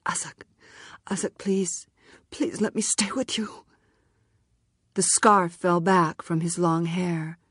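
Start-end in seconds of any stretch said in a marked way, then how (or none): 0.0-3.6 choked, strangled whispered plea; barely getting the words out, strangled. A narrator's reading. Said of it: "saying in a strangled voice".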